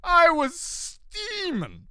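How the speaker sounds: slow, high stretched-out words; slow high drawl. Acted. Admitting to viewers how angry he got, slow and high. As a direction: drag it out, hammed up. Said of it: "admits own past rage".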